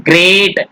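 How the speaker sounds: ग्रेट with a drawn-out ay in one beat; ग्रेट unbroken, as one syllable; single vowel sound. Shown as a demonstration. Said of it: "ए vowel sound निकलना ना".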